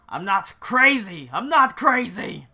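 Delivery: loud, high, escalating outburst; desperate shouted denial; frantic protest. Acted. Lucien protests, swings at his mocker.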